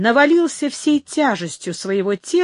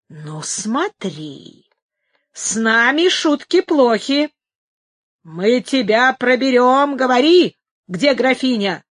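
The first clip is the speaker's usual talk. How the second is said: threatening exclaim; slower than usual. A narrator's reading. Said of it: "воскликнул Фрацко с угрожающим жестом". (угроза: сначала медленно и тихо, потом громко)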